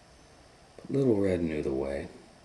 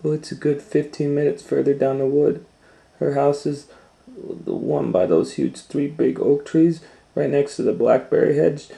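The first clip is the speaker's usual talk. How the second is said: put-on much higher, loud trusting child; chatty little girl; 9 semitones higher, 11 dB louder than usual. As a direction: give directions brightly. Girl describes grandma's house.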